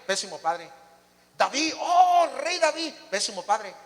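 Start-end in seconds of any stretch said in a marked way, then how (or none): 1.4-2.9 high, loud, exaggerated admiration; mock awe. Preacher exalting David before criticising him as father, exaggerated awe. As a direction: marvel grandly with a drawn-out oh.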